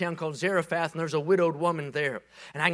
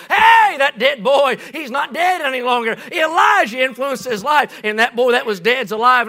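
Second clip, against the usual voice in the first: joyful shout; 7 semitones higher, 11 dB louder than usual. Retold or imitated. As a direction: shout it to the rafters. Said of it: "I'm gonna shout".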